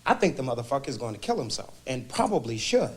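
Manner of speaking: high-pitched, scornful verdict; angry. Acted. Mister Jackson answering the ranting husband in therapy, angry. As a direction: pitch it high and needle him. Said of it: "I'm sensing anger".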